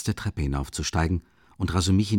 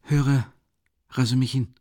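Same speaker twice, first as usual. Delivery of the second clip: put-on raised, feverish young man; Raskolnikov voice; 5 semitones higher than usual. Raskolnikov to his friend, a raised feverish voice. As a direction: speak as the weak, feverish student.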